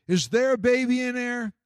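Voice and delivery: put-on a boy poking his head in, asking; curious boy. Boy peeks into the stable.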